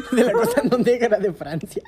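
loud laughing correction. Real, not acted. Friends joking about France's coast, laughing loudly. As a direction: laugh while saying it.

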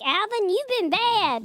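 high, slow scolding chipmunk; hell chipmunk voice. Retold or imitated. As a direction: scold Alvin like a demon chipmunk. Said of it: "if the chipmunks went to hell".

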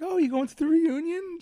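overly sweet mock courtesy; imitating forced friendliness; fake polite. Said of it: "And then I'm like".

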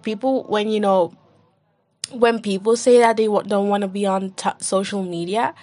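ordinary talk, nothing marked

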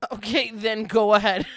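loud, startled go-ahead. Real, not acted. Co-host startled by eager pet-peeve answer, startled reply. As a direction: blurt it out loudly, taken aback. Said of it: "My God, you scared me".

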